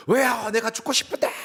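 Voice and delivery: high, loud adolescent outburst; teenage defiant yell. Acted. Preacher acting Jonah as a teen talking back to God, yelling. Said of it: "소리 지르는 거 똑같은 것 같아요".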